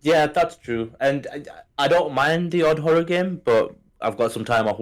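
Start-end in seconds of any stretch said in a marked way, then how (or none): none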